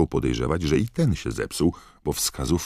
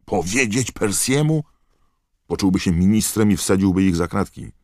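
high, loud, outraged protest; Ron, indignant; 7 semitones higher, 6 dB louder than usual. A narrator's reading. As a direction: scoff indignantly at the suggestion. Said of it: "oburzył się Ron".

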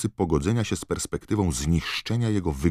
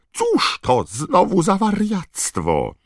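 wysoko i głośno, wolno; piskliwy głos; 8 dB louder, slower than usual. Acted. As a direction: nadal piskliwie, z niedowierzaniem. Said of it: "piskliwym głosem". (piskliwie i z oburzeniem)

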